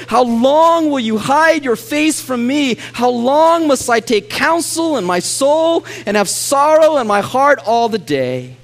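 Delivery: high, loud fervent preaching of the psalm; preaching lament. Acted. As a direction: cry the psalm out to heaven.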